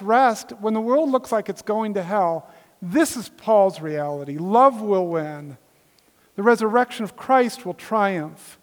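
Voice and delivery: forceful, rising proclamation of hope; ringing sermon declaration; emphatic preaching. Real, not acted. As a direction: preach each promise as a sure triumph, landing hard on key words.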